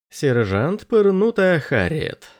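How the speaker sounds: slow, grand announcement of the name; proclaiming. A narrator's reading. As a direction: proclaim it like a title. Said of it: "провозгласил Андерс".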